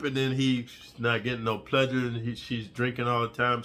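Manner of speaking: ordinary talk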